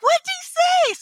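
excited high question. Retold or imitated. Her friends pressing her about the stranger, excited and high. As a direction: squeak it out, dying to know.

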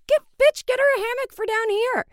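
very high, loud mock outburst; exasperated shriek. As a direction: screech it in disbelief. Exasperated retort about hammock.

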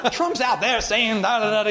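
lightly voiced comic riffing on a rival; Chris Rock impression. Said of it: "he's starting to act like Chris Rock".

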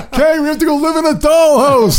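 hammed-up posh Michael. Mock Michael voice.

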